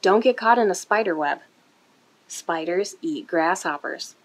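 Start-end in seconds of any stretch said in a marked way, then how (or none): none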